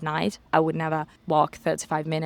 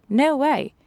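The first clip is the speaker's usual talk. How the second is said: a louder, higher no way; firm refusal; 8 semitones higher, 5 dB louder than usual. Retold or imitated. Bouncer refusing to let her walk alone, louder and higher.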